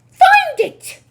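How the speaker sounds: very high, loud witch scream. Acted. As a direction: scream the order. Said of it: "screamed the Grand High Witch".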